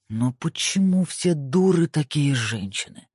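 slow, menacing growl. A narrator's reading. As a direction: growl it out slowly. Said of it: "прорычал он".

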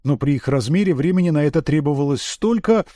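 plain reading voice

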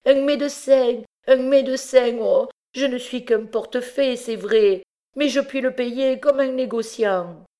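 high, loud wailing plea; lamentable cry. Acted. Coombe crying for a doctor over Miette's cold hands, high wailing plea. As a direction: wail for a doctor in anguish. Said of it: "s'écriait d'une voix lamentable".

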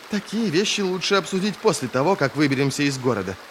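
ordinary delivery

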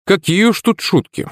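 high-pitched, wry dismissal; mild snort of irony; wry scoff. A narrator's reading. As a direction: scoff it lightly. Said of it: "хмыкнул я".

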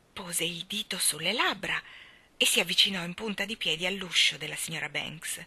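ordinary delivery, nothing marked

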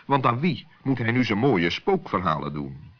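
ordinary delivery, nothing marked

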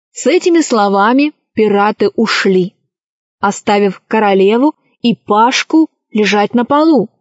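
plain reading voice

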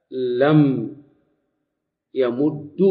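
لم, pause, then يمد pronounced "yamuddu"; final damma. Shown as a demonstration. Teacher demonstrating the third allowed form, يمد ending in u. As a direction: separate لم from يمد and end on a drawn-out u. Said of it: "أو بضم".

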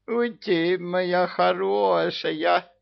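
high, slow cooing; lisping baby talk. A narrator's reading. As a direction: coo at her in baby talk. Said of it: "засюсюкал бессмертный". (сюсюканье)

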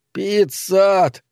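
high, drawn-out call of a full name; stretched, far-carrying cry; shouting. A narrator's reading. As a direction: holler the name into the void. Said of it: "закричал он вновь".

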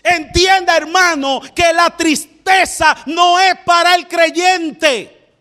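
rising into a shouted, half-sung preacher's cry; preaching at full force. Acted. Preacher urging the congregation, shouting in song-like bursts. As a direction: sing out the exhortation like a revival preacher.

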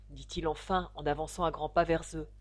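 usual speaking voice

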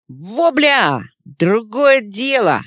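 loud, pleased outburst; excited exclamation. A narrator's reading. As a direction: burst out with delight.

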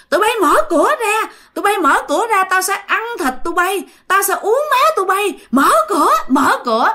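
high, fast, hammed-up monster yell; screaming threats. Possessed mother outside the door threatening to drink blood, high acted scream. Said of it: "bạn la hét um sùm".

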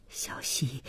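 very soft, low murmur of a name; barely voiced, low call; faint plea. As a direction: breathe the name out helplessly. Faint plea during a breakup.

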